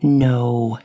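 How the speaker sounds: calm, kind grasshopper no; reassuring. A narrator's reading. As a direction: soothe her worry. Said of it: "Charlie replied reassuringly".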